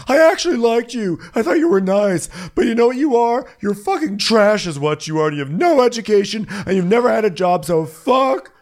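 shrill-high, loud mock rage; angry Emily. Friend imitating angry Emily, high and loud. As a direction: impersonate her screaming fury, pitch way up. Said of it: "Emily's like".

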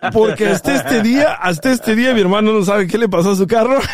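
real giggly admission; laughing confession. Real, not acted. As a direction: confess it while cracking up. Confesses secret while everyone laughs.

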